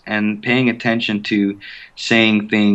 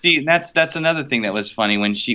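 ordinary delivery

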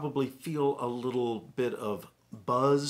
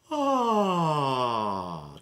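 yawning sigh; 5 dB louder than usual. Shown as a demonstration. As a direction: open yawn sigh on ah. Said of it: "Try that".

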